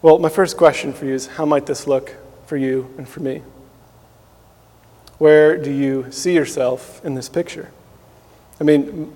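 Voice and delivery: ordinary talk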